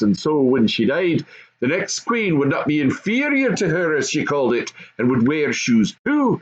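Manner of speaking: high, lively goblin father; goblin father explaining. Acted. Goblin father explaining the queen's shoes to his son. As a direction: explain it like a gossipy goblin dad.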